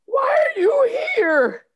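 high, slow cry of fear; terrified yell. Acted. Bartender faces another time traveler, terrified yell. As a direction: plead at the top of your voice.

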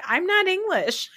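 a hint of an indignant Dorit; imitating Dorit. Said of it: "and even Dorit's like".

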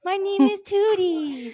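high, slow cartoon kid voice; squeaky kid character voice; childlike cartoon voice. Imitating kids' show character.

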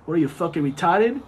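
hammed-up Boston accent, r's dropped; Mark Wahlberg. Co-host demonstrating his celebrity impression, put-on Boston accent. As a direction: do an indignant Boston accent with rising question. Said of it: "my Mark Wahlberg impression".

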